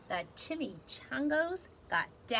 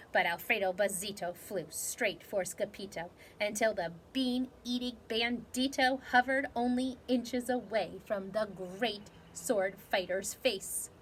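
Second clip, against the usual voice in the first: ordinary delivery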